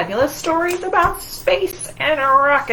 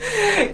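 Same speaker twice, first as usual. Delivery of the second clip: light laugh; 11 semitones higher than usual. Real, not acted. Laugh after tree.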